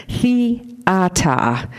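Theata in Boston style, final r dropped to "ah"; badly faked Boston Theata, no r; attempted Boston accent. Shown as a demonstration. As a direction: say Theata with a drawn-out Boston "ah" ending. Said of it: "See, I don't do Boston accents".

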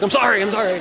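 repeated mock-anxious apology; apologetic dog. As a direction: play a guilty dog begging forgiveness. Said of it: "he's just like".

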